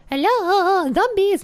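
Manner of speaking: high sing-song hello; playful calling out. Pretend. Player checking for zombies in the theatre, playful high call. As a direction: call out teasingly in a high lilt.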